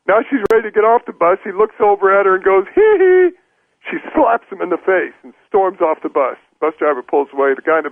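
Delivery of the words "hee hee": high strangled "Hee hee" while faking a choke; high strangled hee hee, hands on his neck; choking hee hee. Acted. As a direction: squeeze out a high choked giggle. Bus driver mock-choking as she leaves.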